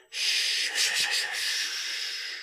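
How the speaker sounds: soft hushing; calm him down gently; father hushing his upset son; soothing shush. A narrator's reading.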